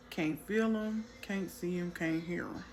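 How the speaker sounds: ordinary delivery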